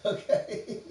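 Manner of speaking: high, loud laughing word; cracking up. Real, not acted. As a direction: squeal it out through laughter. Cracking up at own joke.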